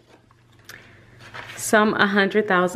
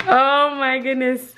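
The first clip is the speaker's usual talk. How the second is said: delighted laugh; 7 semitones higher than usual. Real, not acted. Opening YouTube silver plaque.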